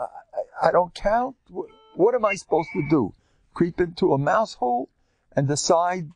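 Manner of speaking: a hint of a wounded pleader; whiny plea. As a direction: play the overlooked one begging. Acted plea of the neglected one.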